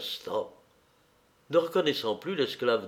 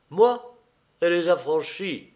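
triumphant reply; 6 semitones higher, 4 dB louder than usual. A narrator's reading. Sporus triumphantly admits it.

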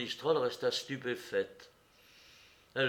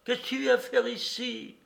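high, louder demanding question; indignant challenge; 11 semitones higher, 6 dB louder than usual. A narrator's reading. Woman at window challenges Courrapier, indignant. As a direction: demand an answer sharply.

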